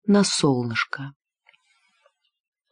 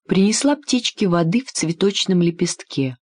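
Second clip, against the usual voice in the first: usual speaking voice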